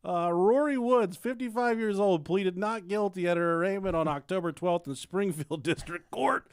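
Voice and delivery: high laughing news read; laughing while reading. Real, not acted. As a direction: read the article through laughter. Reads news article laughing.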